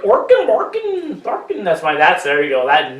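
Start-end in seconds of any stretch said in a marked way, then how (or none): 0.0-1.6 high sing-song mock Swedish gibberish; hammed-up Scandinavian babble, drawn out and high; Scandinavian accent. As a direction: do a Muppet-style Swedish chef. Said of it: "That's Scandinavian accent".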